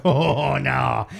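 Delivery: loud, drawn-out mock "oh no"; hammed-up dismayed groan of words; retold dismay. Retelling his reaction to her accent, mock dismay.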